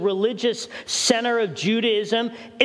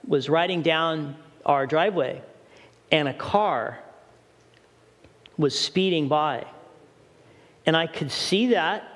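normal talking voice

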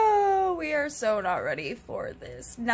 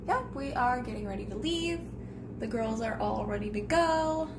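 usual speaking voice

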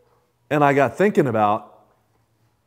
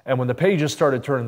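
usual speaking voice

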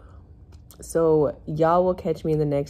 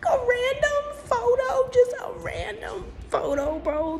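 much higher, disbelieving squeal; outraged shriek. Real, not acted. Complaining about the driver's photo, high outraged squeal.

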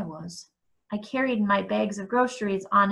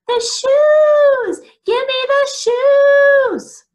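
very high, loud mocking shriek; high-pitched scream; 10 dB louder, much slower than usual. A narrator's reading. Eric grabbing at Arthur's shoes in mockery, high-pitched scream. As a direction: scream it shrilly, dragging out "shoes". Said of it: "screamed in a high-pitched voice".

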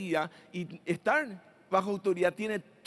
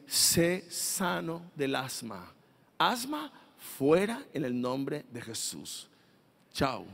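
lightly acted, slow and low healing command; praying brother; 5 semitones lower, 3 dB quieter, slower than usual. Brother praying over someone with asthma, slow low command. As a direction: pronounce the healing slowly, with measured calm. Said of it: "Y él te va a decir".

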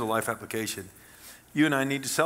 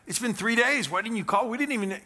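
scolding worried mom; 10 semitones higher, 4 dB louder than usual. Retold or imitated.

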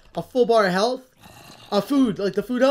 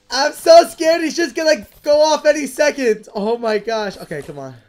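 usual speaking voice

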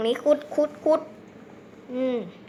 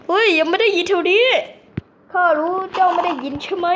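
villager voice; 5 semitones higher, 5 dB louder than usual. Pretend. Villager voiced with archaic speech.

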